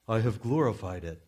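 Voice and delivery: second stab at a mighty God; hammed-up divine proclamation; put-on voice from heaven. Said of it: "hard to do the God voice".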